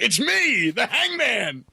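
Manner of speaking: high, hammed-up big reveal; masked wrestler's entrance. Masked wrestler surprising a kid in hospital, hammed-up. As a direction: announce yourself like a cartoon wrestler. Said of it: "there's a guy in a mask with a noose, like".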